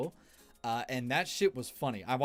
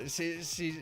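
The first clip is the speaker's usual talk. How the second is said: usual speaking voice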